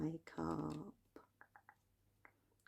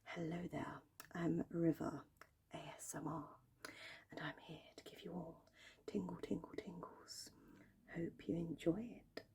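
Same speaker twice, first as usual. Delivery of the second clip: light posh whisper imitation; posh River voice; 3 semitones higher, 5 dB quieter than usual. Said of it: "try and do that posh voice that he does".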